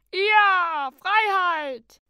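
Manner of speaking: high, loud drawn-out cheer; jubilant shout. A narrator's reading. Little orc sailing off free, jubilant high shout. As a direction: cry out in triumph. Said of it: "rief der kleine Ork".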